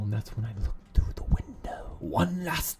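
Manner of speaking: eerie whisper. Acted. Eerie dream, something watching.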